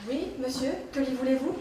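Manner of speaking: very soft, low, wary reply; hushed politeness to an official; meek answer. Acted. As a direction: ask cautiously, keeping the voice down. Answers the bailiff warily.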